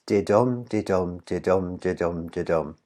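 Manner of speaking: DIDUM chanted with the second syllable stressed; five iambic beats. Shown as a demonstration. Teacher counting five stresses in the line, iambic beats. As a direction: beat out didum evenly, weak then STRONG. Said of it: "there are five stresses".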